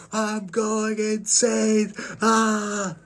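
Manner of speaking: high, drawn-out mock wail of madness; going insane. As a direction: wail it slowly, hammed-up, on one high note. Imagines Lovecraft losing his mind.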